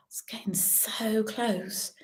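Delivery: hushed, suspenseful whisper. Acted. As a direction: whisper it like a secret. Tense moment in bingo game.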